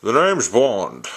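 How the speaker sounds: slow, loud Scottish Bond voice; amateur Connery-style Scottish accent; Sean Connery impression. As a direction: announce yourself as a Scottish secret agent, big and slow. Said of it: "I'm not good at a Sean Connery accent".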